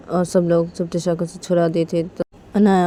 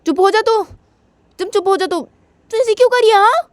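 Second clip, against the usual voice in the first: louder, rising high angry scolding; slap-and-scold, getting higher; angry brother; 10 semitones higher, 5 dB louder than usual. Retold or imitated. Brother scolding Tisha after slapping her, angry. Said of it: "बोलते".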